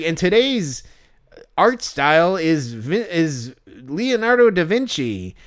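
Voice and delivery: slow, stilted show announcer; canned hot dog host. Acted. Hot dog character reveals today's art style, canned and stilted. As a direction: announce it with weird, dragging pacing. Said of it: "that's a good impression that you're doing".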